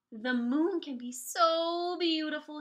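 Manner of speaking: usual speaking voice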